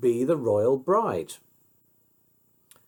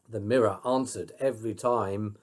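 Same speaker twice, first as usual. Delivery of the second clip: plain reading voice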